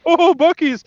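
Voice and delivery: high-pitched mock awe; mock excitement. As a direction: gush in mockery of the hype. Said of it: "it's just like".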